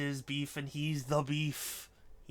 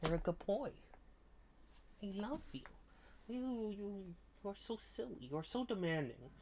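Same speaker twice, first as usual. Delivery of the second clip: high, cooing sing-song; 7 semitones higher, 3 dB quieter, faster than usual. Real, not acted. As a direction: gush at the silly cat. Baby-talking her cat.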